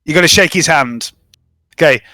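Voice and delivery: normal talking voice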